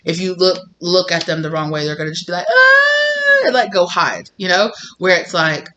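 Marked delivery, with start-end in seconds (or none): 2.5-3.4 very high, loud hammed-up scream; oversensitive mock shriek. Sensitive inner child fleeing from a wrong look, mock shriek. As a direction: squeal as if startled by a mere look. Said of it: "touch and go sensitive".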